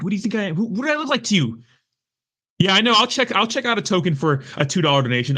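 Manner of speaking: high-pitched, worked-up indignant rant; fired up. Real, not acted. Streamer refusing a viewer's money for removing a mod, fired up. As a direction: throw it back at them, pitch climbing. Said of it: "I'm getting, getting fired up".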